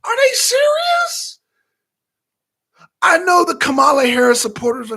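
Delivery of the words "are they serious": high, loud and slow disbelief; can't believe what you're seeing; shocked disbelief. Retold or imitated. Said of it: "I was like".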